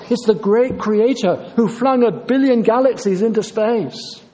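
ordinary talk

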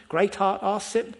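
ordinary talk

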